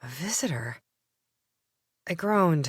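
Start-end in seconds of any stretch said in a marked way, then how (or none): 0.0-0.8 groggy breathless whisper; half-awake, barely voicing it. Narrator just woken by Carl, sleepy whisper.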